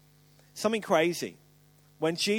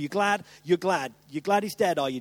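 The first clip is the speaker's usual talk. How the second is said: ordinary talk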